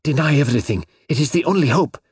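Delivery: usual speaking voice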